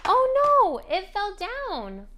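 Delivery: hammed-up oh no for a child; mock surprise. Shown as a demonstration. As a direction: gasp over the fallen tower for a toddler. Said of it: "make it fall and say".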